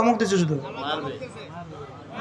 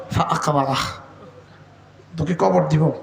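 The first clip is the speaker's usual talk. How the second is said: deep solemn threat; 7 semitones lower than usual. Acted. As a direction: say it low and heavy like a verdict. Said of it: "তো আল্লাহ তাআলা বলেন". (নিচু গম্ভীর সুরে সতর্কবাণী)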